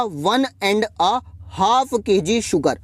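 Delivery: slow, careful and clear; slow model phrase. Shown as a demonstration. Teacher asking students to listen closely, slow. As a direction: say it slowly so they catch it. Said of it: "ध्यान से सुनिएगा".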